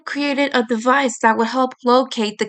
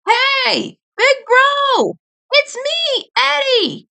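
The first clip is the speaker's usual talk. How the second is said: shouting; slower than usual. Acted. Eddie calling to his brother at the factory, shouting. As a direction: call up to the building. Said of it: "he began shouting".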